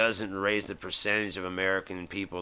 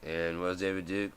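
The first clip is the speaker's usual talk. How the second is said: usual speaking voice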